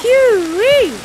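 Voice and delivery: high, loud relieved whoop; relieved laughter. Real, not acted. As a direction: let the relief burst out. Safe home after the scare.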